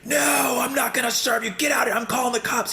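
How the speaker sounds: high, strained mock shout at the customer; imitated screaming. Store owner ordering the customer out, high mock shout. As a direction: blow up like the furious owner. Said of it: "he's just screaming and yelling".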